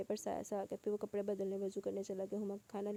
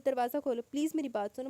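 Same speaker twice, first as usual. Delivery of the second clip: louder, higher begging call; loud pleading; 3 semitones higher, 7 dB louder than usual. A narrator's reading. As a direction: plead through the door, raised. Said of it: "باہر سے وہ بھی التجا کر رہا تھا". (بلند آواز میں منت)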